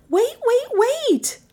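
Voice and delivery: very high, shrill urgent cry; shrill it out at top pitch. A narrator's reading. Passenger stopping the driver, shrill. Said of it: "Melissa shrilled".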